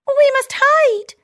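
very high, loud squeaky cry; shouting mouse. Acted. Town mouse warning her friend of the people, loud high squeak. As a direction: sound the alarm in a tiny voice. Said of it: "shouts the town mouse".

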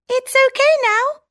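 high squeaky mouse, relieved; all clear in a tiny voice; town mouse voice. Acted. Town mouse telling her friend the danger's gone, high squeak. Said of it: "says the town mouse".

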